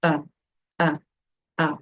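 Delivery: A as a short "uh" with dropped jaw; short, slack A, close to "uh"; relaxed A. Shown as a demonstration. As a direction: lax A, jaw simply released. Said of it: "relaxando um pouco mais esses músculos da face".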